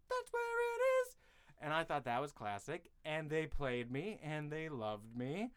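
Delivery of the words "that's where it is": sung in a very high falsetto. Retold or imitated. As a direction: float "That's where it is." up into falsetto. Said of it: "a high falsetto".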